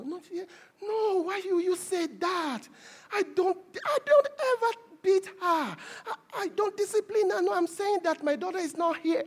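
high-pitched, protesting mother; imitated offended mother. Mother denying to the doctor she disciplines her daughter, offended. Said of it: "she took offense because I asked".

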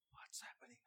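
breathy whisper under the breath; whispered thought. Retold or imitated. Whispering to himself as soldiers stare him down. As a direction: whisper it as if afraid to be heard.

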